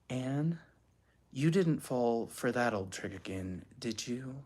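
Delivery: soft, unruffled mother; low, calm. A narrator's reading. Weary mother to panicking daughter, low and calm. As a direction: say it quietly, without alarm. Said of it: "She said in a low, calm voice".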